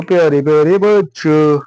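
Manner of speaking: put-on affectionate loud greeting to kids; recorded take. Shown as a demonstration. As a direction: call the dear children out warmly. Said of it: "गलत बन गया क्या भैया?".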